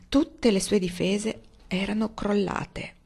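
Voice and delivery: usual speaking voice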